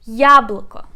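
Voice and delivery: "Яблоко" with a loud, punched Я; stress on first syllable. Shown as a demonstration. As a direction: hit the opening Я of "Яблоко" hard. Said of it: "We put stress on the first syllable".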